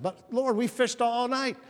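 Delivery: high, loud, drawn-out objection; acted loud protest. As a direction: protest at full voice like an exasperated fisherman. Disciples protesting to Jesus, acted.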